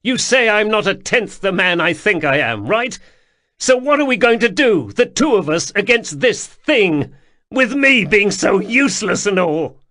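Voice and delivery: high, loud, voice close to cracking; near tears, shouting. Acted. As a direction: shout it on the edge of crying. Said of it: "heard his voice crack".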